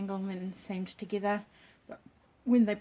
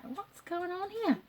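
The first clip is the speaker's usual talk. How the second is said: higher and slow, baffled at the crochet; puzzled surprise; 9 semitones higher, 4 dB quieter, slower than usual. Retold or imitated. Retelling finding the short point on her blanket, mock puzzled. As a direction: act out spotting the uneven point. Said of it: "I was like".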